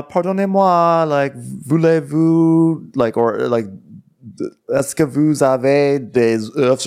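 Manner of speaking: high, drawn-out attempts at French; mock halting French. Friend trying French on Parisians, high and hesitant. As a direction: stretch each French phrase, politely searching.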